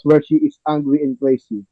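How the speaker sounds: ordinary talk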